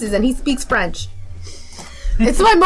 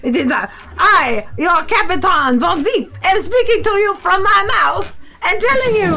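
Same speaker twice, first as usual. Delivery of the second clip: put-on grand French-accented captain's announcement; Von Zief's French accent; 4 semitones higher than usual. Captain addresses his crew through a tube, French accent. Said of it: "That is very French also".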